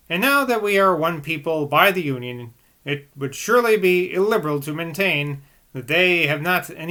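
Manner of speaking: put-on measured, even reading of an old text; calm literary reading voice; reading aloud. A narrator's reading. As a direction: read it like a steady audiobook narrator.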